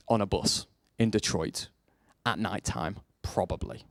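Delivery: hushed storyteller aside; hushed for drama. Shown as a demonstration. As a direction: drop to a near whisper for effect. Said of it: "for dramatic effect".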